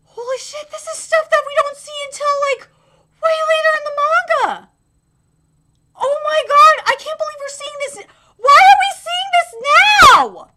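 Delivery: high, agitated exclaiming; excited disbelief. Real, not acted. Fan stunned by an early manga reveal, excited disbelief. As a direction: build from astonishment to a loud, high cry.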